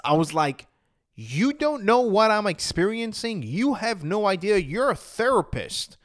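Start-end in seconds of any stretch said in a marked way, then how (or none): 1.1-6.0 high, louder indignant protest; stretched, emphatic incredulity, pitch raised; freaked-out disbelief. Retold or imitated. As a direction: re-enact freaking out at the therapist. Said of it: "I freaked out because".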